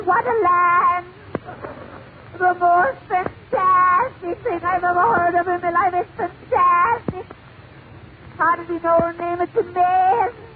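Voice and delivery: hammed-up awestruck newcomer; amazed Irish immigrant. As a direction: marvel in a high, monotone comic wail. Irish immigrant marvels in joke.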